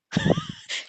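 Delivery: quick high-pitched giggle; brief laugh at your own story. Real, not acted.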